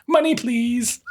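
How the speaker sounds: lightly voiced child asking for cash; imitating the daughter. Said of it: "She's gonna be like".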